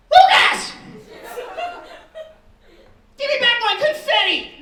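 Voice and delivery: put-on shrill child's shriek, way up high and loud; screaming kid. Kindergartner screaming at a classmate over stolen confetti, shrill shriek. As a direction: scream it like a furious little kid. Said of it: "He's screaming at Lucas".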